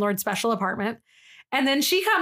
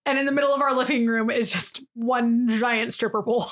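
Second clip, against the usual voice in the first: ordinary talk, nothing marked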